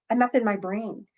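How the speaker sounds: ordinary talk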